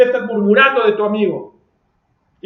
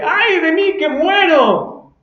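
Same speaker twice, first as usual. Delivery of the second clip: sincere wail; 7 semitones higher, 4 dB louder, much slower than usual. Retold or imitated. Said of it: "Eso lo dijo sinceramente".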